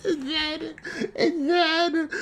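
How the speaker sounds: high, soft, slow crying voice; tearful. Real, not acted. Fan trying to carry on retelling, crying. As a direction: squeeze it out high through tears. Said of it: "this is making me upset".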